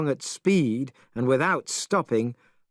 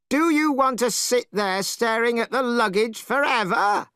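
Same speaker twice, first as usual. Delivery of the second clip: Monkey still yelling at the priest; angry shouting; 10 semitones higher than usual. Acted. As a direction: keep shouting in contempt. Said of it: "He was still shouting angrily".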